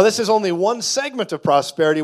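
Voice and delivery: ordinary talk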